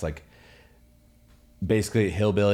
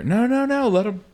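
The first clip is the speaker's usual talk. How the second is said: excited pleading; 6 dB louder than usual. Real, not acted. The cat wants to come in.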